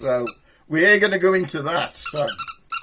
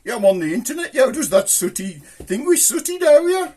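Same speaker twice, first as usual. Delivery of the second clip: mock fan voice; 6 semitones higher than usual. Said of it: "folks have been coming up to me and says".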